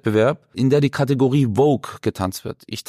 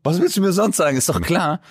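teasing retold reply; 4 semitones higher than usual. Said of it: "Und dann hab ich gesagt".

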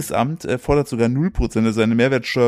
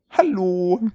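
put-on toddler voice; 6 semitones higher than usual. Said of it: "es klingt wie Oh Long Johnson".

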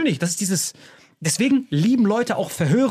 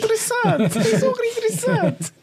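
very high squeaky mock enthusiasm; hammed-up fascination. Friend pretending to study integrals, hammed-up high fascination. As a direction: squeal it through laughter as if fascinated. Mimics Sergei admiring the maths book.

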